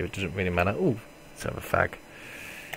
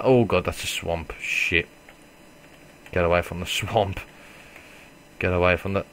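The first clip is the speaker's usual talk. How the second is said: ordinary delivery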